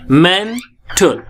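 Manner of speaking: "Mental" slowly, rising on men, falling on tal; first-syllable stress, split. Shown as a demonstration. Teacher drilling primary stress in "mental", slow and split. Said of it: "मेन, इसलिए पहली stress".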